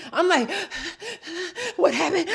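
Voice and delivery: high, hushed words between gasps; hammed-up panic breathing; mock hyperventilating. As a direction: gasp for air around squeaky little words. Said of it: "like, hyperventilating".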